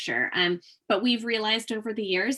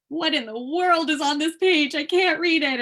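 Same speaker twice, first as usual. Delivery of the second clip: higher, louder baffled question; puzzled volunteer; 7 semitones higher, 6 dB louder than usual. Retold or imitated. Volunteer stuck on a page, asking aloud, baffled and raised. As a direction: voice a stumped volunteer squinting at a page.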